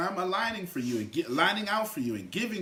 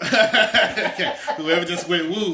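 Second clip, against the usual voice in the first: louder, laughing through the words; 8 dB louder than usual. Real, not acted. Speaker laughing at the audience's woo. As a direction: crack up at the cheer.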